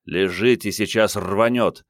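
plain reading voice

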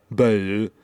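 exaggerated French-style Bayou; mock French. Shown as a demonstration. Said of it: "French is just".

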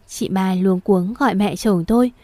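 ordinary delivery

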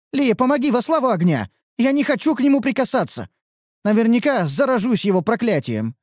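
high, desperate begging; pleading whine. Acted. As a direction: beg your friend for help. Elf repeats her plea.

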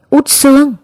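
narrated scream of horror, held back; restrained horrified cry. A narrator's reading. Hai Trà cries out to Út Sương's spirit, restrained horror. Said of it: "một tiếng thét kinh hoàng".

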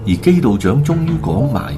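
ordinary talk

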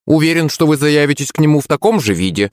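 usual speaking voice

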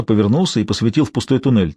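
ordinary delivery, nothing marked

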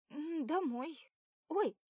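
put-on girl crying out in surprise; sudden cry. A narrator's reading. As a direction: yelp out of nowhere. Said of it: "тут же вскрикивает она".

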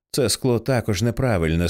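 usual speaking voice

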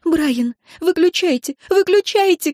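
high frantic shout, climbing with each word; sob-edged yell, rising in urgency; crying out. A narrator's reading. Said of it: "кричала Энн".

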